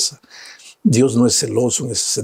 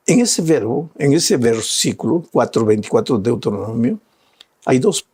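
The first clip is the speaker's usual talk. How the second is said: usual speaking voice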